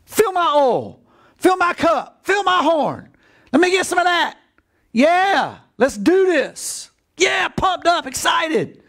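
high, eager cheering, shouting near the end; pumped-up excitement. Acted. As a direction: sound fired up and raring to go.

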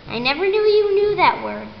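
usual speaking voice

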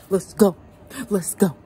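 shouting through the cold plunge; in ice cold water. Real, not acted. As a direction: hype yourself up, loud, in freezing water. Psyching up for the ice bath.

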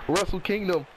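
usual speaking voice

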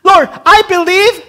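loud, high pleading voice; pleading paraphrase. Retold or imitated. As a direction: beg the Lord out loud. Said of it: "He's basically saying".

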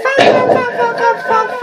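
very high squeaky imitation. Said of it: "It's like".

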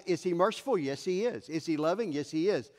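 ordinary talk, nothing marked

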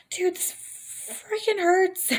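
high, slow pained cry; pained complaint. Retold or imitated. Patient complaining to the doctor, high slow pained cry. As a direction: drag it out like it really hurts. Retold pain during water breaking.

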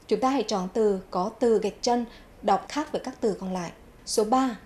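usual speaking voice

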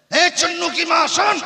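high put-on husband's call; calling the wife. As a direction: summon her across the house. (पति की ऊँची पुकार)

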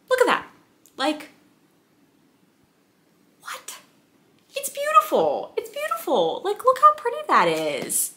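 tiny high-pitched squeal of delight; gushing awe. Real, not acted. Showing viewers the stencil and sprayed edges, awed high squeal. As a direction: squeak in awe at something beautiful.

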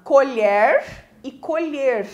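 COLHER twice, open E then closed E; slow contrast of the two vowels in colher. Shown as a demonstration. Teacher introducing a homograph, contrasting open and closed colher. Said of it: "uma tem pronúncia com o som aberto".

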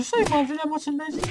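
high, fast panicked rush. Real, not acted. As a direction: blurt it out in panic. Lost half his health.

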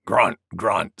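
low boar grunts; grunting wild boar. A narrator's reading. Wild Boar joining the animals, low grunts. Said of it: "said Wild Boar".

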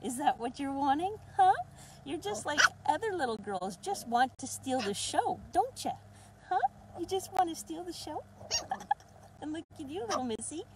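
put-on high, sing-song baby talk to puppies; talking to the puppies in a high cooing voice; puppy talk. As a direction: coo at the puppies like they're toddlers.

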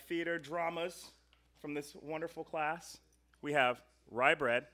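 ordinary delivery

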